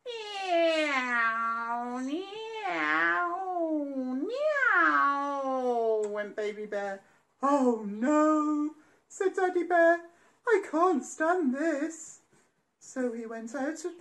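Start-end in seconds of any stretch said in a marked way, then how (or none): 0.1-6.0 playful plane noise, a bit louder; mock aeroplane noise. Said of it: "pretending to be an aeroplane". 7.4-12.2 louder fed-up groan, slow; exasperated daddy bear. Acted.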